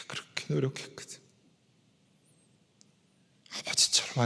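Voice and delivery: breaking into an airy, soft whisper; halting, hushed confession with long pauses; choked up. Real, not acted. Husband confessing how he longed for his wife's words, choked up. Moved by wife's thankful letter.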